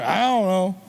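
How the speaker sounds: low, mumbled, barely there; grunted teenage reply; sullen teen mumble. Retold or imitated. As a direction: mumble it like a bored kid who won't talk. Kid mumbling answers to parent.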